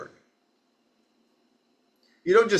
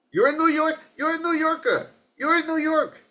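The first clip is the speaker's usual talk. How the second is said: put-on very high-pitched old New York accent; high, insistent New York accent; 12 semitones higher than usual. Repeats line in father's New York voice.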